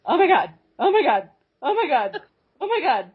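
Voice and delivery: stunned disbelief. Retold or imitated. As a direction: gasp it out, stunned in bed. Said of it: "sitting there in bed going".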